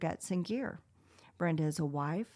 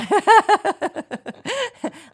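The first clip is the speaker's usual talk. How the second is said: loud, high burst of laughter; big laugh; 15 dB louder than usual. Real, not acted. Host cracking up at the guest's accent retort, laughing hard.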